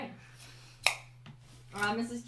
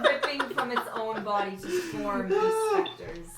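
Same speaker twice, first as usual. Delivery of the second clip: laughing; 6 semitones higher, 9 dB louder than usual. Real, not acted. DM laughing while describing.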